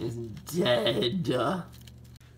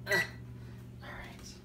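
whispered; 5 semitones higher, 10 dB quieter than usual. Real, not acted.

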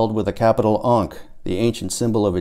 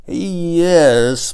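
admitting; 8 semitones higher, 5 dB louder than usual. A narrator's reading. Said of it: "Paul admitted".

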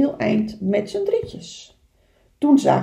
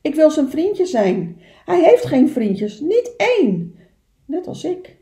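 lonely little beaver; 6 semitones higher, 6 dB louder than usual. Acted. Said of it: "vroeg Kleine Beer".

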